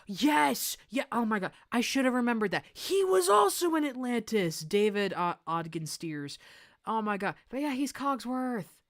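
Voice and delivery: high, loud fan excitement on recognizing him; excited. Real, not acted. Streamer to chat, thrilled at recognizing the actor, loud and high.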